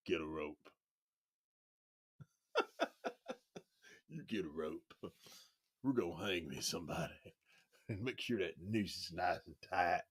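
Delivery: a quiet threat from the ad; low growl. Retold or imitated. Quoting the ad's lynch-mob line, low and quiet. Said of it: "that salsa commercial".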